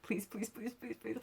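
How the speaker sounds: soft, rapid muttered begging; quiet little string of pleas; retold pleading. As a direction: plead under your breath, over and over. Retold begging not to vomit.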